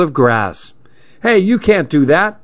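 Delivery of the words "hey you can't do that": high, yappy squeal like an angry chihuahua. A narrator's reading. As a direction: squeal it like a small angry dog. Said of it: "the maniac squealed".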